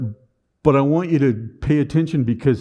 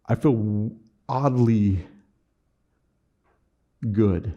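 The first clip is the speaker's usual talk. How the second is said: wondering surprise; 2 semitones lower, much slower than usual. Retold or imitated. Surprised realization in truck.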